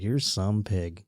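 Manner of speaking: low, soft, slow affection; endearing. Retold or imitated. Boyfriend's pet compliment to his girlfriend, retold endearingly. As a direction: tease it tenderly, drawn out and quiet. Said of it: "I'd say that, like, endearingly".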